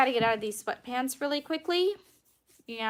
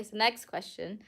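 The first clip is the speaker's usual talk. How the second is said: ordinary talk, nothing marked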